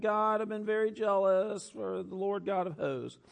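high, put-on whine; whiny voice. Preacher playing Elijah complaining to God, high whiny voice. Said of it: "Elijah starts to get his whiny voice".

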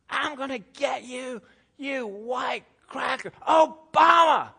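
high, slow, stretched mock scream, shouting on the last word; rising imitated yell ending in a loud shout; screaming man. Pastor imitating the man chasing him, screaming threats. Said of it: "And he is screaming".

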